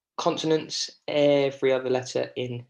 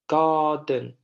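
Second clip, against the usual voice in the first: "garden" with strong GAR, falling quieter den; stressed first syllable; 4 dB louder than usual. Shown as a demonstration. Teacher models a quieter last syllable, stress on first. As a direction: hit GAR in "garden", let den drop off. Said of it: "we say it a bit more quietly".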